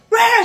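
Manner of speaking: high, loud wordless yell; mock angry scream. Man in car screaming at her, mock scream. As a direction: imitate a man screaming gibberish.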